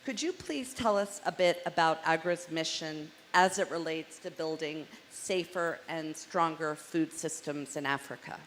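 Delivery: ordinary talk, nothing marked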